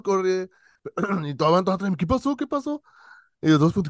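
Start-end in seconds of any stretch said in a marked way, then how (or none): 2.0-2.8 put-on anxious kids asking. Other kids asking what happened.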